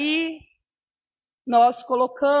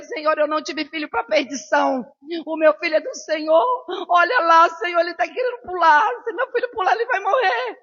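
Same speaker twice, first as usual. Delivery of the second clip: louder, climbing higher, pleading; desperate prayer; 4 semitones higher, 4 dB louder than usual. Retold or imitated. Mother begging God not to let her son jump, loudly pleading. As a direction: cry out to God for a son's life.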